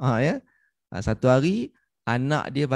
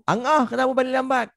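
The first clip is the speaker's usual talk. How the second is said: high-pitched mock telling-off; scolding father; 10 semitones higher than usual. Father confronting his child for coming home late, scolding. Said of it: "Ah, selalu".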